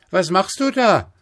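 put-on high, loud child calling up; rufend. A narrator's reading. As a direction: call up to someone high above. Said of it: "rief der kleine Häwelmann hinauf". (hoch und laut hinaufgerufen)

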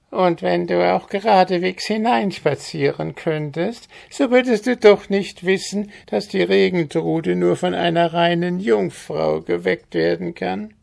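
high rasping goblin sneer; snarling goblin. A narrator's reading. Goblin mocks the farmer with a high rasping sneer. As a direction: sneer down at the fool.

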